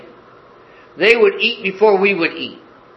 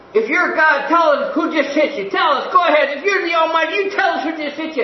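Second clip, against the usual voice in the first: higher, taunting jeers; mocking tormentors; 7 semitones higher than usual. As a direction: sneer it at the beaten prisoner.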